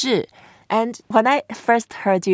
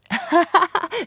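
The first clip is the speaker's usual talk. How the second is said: amused, higher-pitched laugh; 12 semitones higher than usual. Real, not acted. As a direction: burst out laughing at the accent. Amused by the southern accent.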